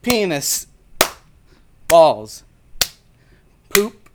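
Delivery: loud silly word blurts; shouted words. Acted. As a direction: blurt each word loudly. Soundboard-style shouted words.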